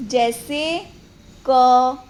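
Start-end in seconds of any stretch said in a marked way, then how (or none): none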